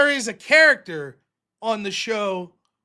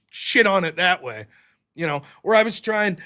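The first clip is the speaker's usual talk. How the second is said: usual speaking voice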